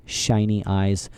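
usual speaking voice

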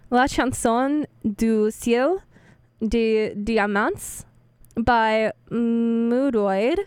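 slow, effortful French title, syllable by syllable; careful French attempt. Real, not acted. Host carefully attempting a French band name on air. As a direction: sound out the French words carefully. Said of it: "I am going to struggle to pronounce this".

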